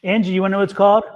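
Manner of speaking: ordinary talk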